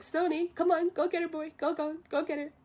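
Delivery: high-pitched coaxing, as if to a dog; acted eager, high-voiced urging. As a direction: egg the sleeper on playfully.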